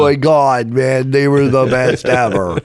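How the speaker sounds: slow, drawn-out stoner lament; imagined stoner voice. Retold or imitated. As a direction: mourn it like a baked hippie. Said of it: "he didn't talk like that".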